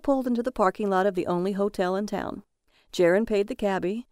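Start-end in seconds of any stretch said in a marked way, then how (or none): none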